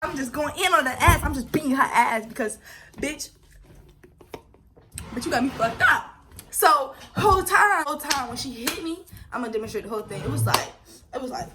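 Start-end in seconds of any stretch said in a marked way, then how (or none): none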